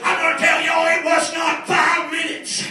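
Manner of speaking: high, shouted revival preaching; impassioned, strained testimony at a shout; full-force preaching. Real, not acted.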